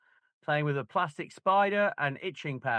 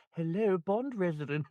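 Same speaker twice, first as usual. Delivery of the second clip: put-on posh English telephone greeting; mock posh English phone voice; 4 semitones higher than usual. Son imitating his mum's posh English phone voice. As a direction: answer the phone in a fake posh English accent. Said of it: "you should have heard my mum answering the phone".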